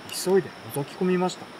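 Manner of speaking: usual speaking voice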